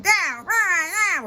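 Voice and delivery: high shrill mocking yell of the protest chant; mock screeching yell. Mocking a congresswoman's screeching chant. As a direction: screech it like a heckler, high and loud. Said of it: "screeching, yelling".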